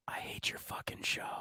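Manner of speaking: hushed mock-hostile whisper. Co-host imagines nurse leaning over to insult host, whispered. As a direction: whisper it right into the ear. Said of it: "leaned over and whispered in your ear".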